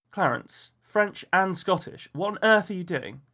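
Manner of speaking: put-on a woman scolding an old friend; exasperated woman. A narrator's reading.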